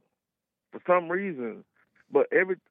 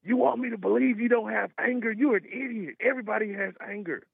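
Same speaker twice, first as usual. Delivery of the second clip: higher, scornful mock of a ranting woman; mocking angry lady; 8 semitones higher than usual. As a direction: imitate her snapping at him, pitched up. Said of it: "And she's like".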